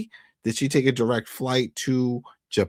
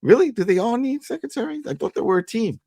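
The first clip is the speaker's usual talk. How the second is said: much higher-pitched, put-on disbelief; mock incredulous questioning; 12 semitones higher than usual. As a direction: act out someone questioning it in a high voice. Acting out a doubtful character's reaction.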